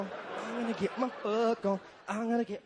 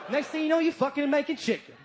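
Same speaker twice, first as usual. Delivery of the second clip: ordinary talk, nothing marked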